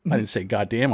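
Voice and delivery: usual speaking voice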